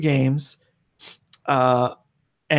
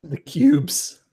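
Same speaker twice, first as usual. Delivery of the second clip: put-on Chicago accent.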